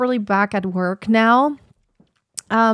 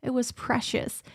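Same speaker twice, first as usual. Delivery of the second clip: wistful; 4 semitones higher, 8 dB quieter than usual. Retold or imitated. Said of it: "I'm going to be like".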